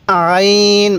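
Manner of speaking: আইন held long with light pressure in the throat; slow model pronunciation of Arabic letter আইন; throat-pressed আইন. Shown as a demonstration. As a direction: lightly squeeze the throat on আইন, sustain it evenly. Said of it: "গলার মধ্যে হালকা চাপ দিবেন".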